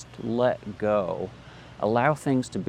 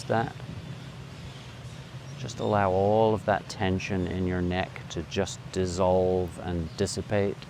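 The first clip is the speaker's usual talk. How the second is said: guided meditation; 3 semitones lower, 4 dB quieter than usual. Acted.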